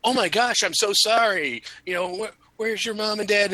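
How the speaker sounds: high mock-sweet apology; fake apology. Waiter faking concern to the kid, mock-sweet. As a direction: put on a sugary fake sorry. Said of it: "you're like".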